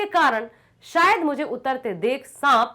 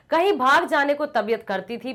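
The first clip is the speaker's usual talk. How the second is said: usual speaking voice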